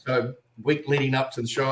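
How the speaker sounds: usual speaking voice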